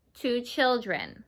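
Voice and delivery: put-on "two children" slow and soft for repeating; slow choral model. Shown as a demonstration. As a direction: lead the class slowly. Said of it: "So say it with me".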